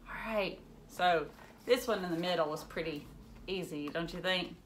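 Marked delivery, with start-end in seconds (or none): none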